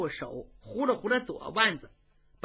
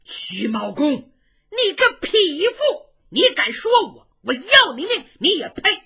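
furious retort; 8 semitones higher, 8 dB louder than usual. Acted. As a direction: spit the challenge back. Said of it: "俩人吵起来".

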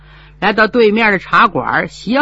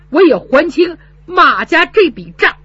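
tearful vow; 7 semitones higher, 5 dB louder than usual. Acted.

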